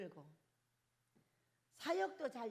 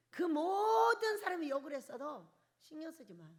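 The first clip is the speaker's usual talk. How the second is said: loud, raised cry of defiance; preaching shout; 3 semitones higher, 6 dB louder than usual. Acted. Preacher urging the congregation to ignore insults, shouting. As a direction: shout it over the crowd's scorn.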